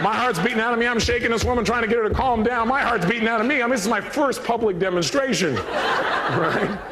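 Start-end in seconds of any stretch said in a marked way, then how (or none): none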